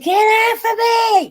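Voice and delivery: very high, loud, drawn-out cry; hammed-up shriek of protest; mock scream. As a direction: squeal it as a cat under attack. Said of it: "screams like, like he's being killed".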